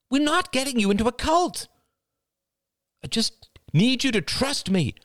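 very high, agitated female voice; high-pitched, urgent arguing; exasperated pleading. A narrator's reading. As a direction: plead as if constantly interrupted on the phone. Wife arguing on the phone, cut off.

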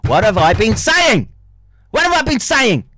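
loud, high-pitched vindication; exasperated shout. Real, not acted. Streamer vindicated by what he read, exasperated shout. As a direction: throw the question out, rising in pitch on the repeat. Exasperated after reading out a text.

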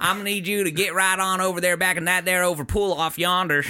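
quick mock-country patter; country coach. Coach directing Sandshrew to the pool, rambling country accent. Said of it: "like a Little League coach".

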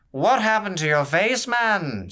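a tipsy courtier jeering up close; slurring drunk courtier. Acted. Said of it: "slurred the courtier".

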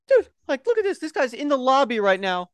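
high-pitched excited hiss to a coworker; excited urgent call. Retold or imitated. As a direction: squeal it out, barely containing the scandal. Telling coworker about porn customer.

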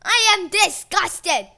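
slow, loud disgust. A narrator's reading. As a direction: spit out her disgust. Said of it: "said Gaia".

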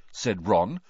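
ordinary delivery, nothing marked

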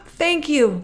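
high, loud, overjoyed thanks; girl thanks the roses, overjoyed; crying out thanks. A narrator's reading. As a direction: burst out with grateful joy. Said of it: "Gerda cried".

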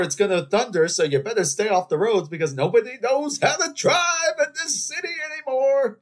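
high, loud shouted forecast; hammed-up weatherman. Warning drivers off the roads as a TV weatherman, shouting. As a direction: yell the warning like a frantic TV forecaster.

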